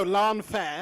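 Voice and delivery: "Lan Fair" loud and drawn out; "Lan Fair" as two English words; English pronunciation. Shown as a demonstration. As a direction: correct it firmly to a slow "Lan Fair". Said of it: "language to speak in an English accent".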